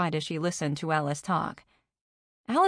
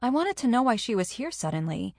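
ordinary delivery

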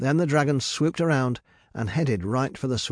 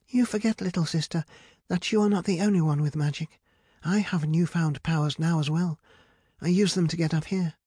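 higher-pitched voice, schooling a little sister; superior older sister; 6 semitones higher than usual. Acted. Rapunzel reminds Medea of her powers, superior. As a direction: put her in her place in a raised pitch.

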